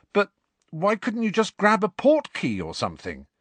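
why on earth, incredulous; Ron baffled. A narrator's reading. Ron baffled by slow travel.

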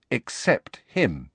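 plain reading voice

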